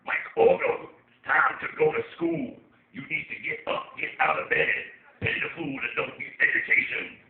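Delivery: loud, rough growling impression; Mister T. Phone assistant getting the user out of bed, Mister T impression. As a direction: bark orders in a hammed-up gruff voice. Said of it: "I'm hoping that Mister T is one of the".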